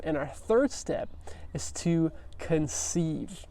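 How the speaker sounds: hushed, slow whisper for the reveal; dramatic whisper. Shown as a demonstration. Teacher whispers the third step to the class, slow and hushed. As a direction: drop to a secretive whisper to build suspense.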